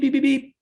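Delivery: loud, high mock beeps like an alarm; imitated beeping. Manager to robber, mimicking the safe, loud high beeping. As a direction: squeal out the safe's beeps.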